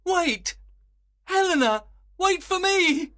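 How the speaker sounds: high, loud call after someone; pleading cry. A narrator's reading. Lysander chases Helena into the wood, calling out. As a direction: call out to stop her leaving. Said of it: "cried Lysander".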